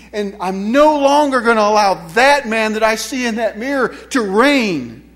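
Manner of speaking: loud, impassioned preaching; emphatic declaration. Acted. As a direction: declare it with rising conviction.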